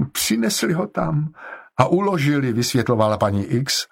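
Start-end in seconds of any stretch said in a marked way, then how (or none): 0.1-2.5 higher-pitched, mildly tearful explaining; explain, close to tears; plačtivé vysvětlování. A narrator's reading. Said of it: "dala se do pláče". (mírně plačtivě)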